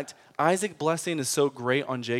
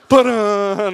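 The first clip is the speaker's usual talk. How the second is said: loud, higher dramatic ba-dun sting; soap opera sting; 6 semitones higher, 8 dB louder than usual. Pretend. Mocking the story as a bad Spanish soap opera, loud dramatic sting. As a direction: hit it like a cheesy soap opera cliffhanger. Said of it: "supposed to be like a soap opera".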